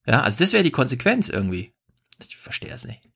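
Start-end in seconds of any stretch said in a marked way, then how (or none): 2.3-3.0 baffled whisper. Real, not acted. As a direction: breathe it out in disbelief. Baffled aside after rant.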